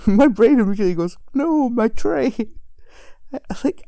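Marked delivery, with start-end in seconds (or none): 1.3-2.3 high, slow mock wail; mock dismay. Dreamer watching her tray get broken, mock dismayed wail. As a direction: lament it with hammed-up anguish.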